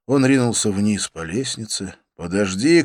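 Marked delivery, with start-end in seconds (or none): none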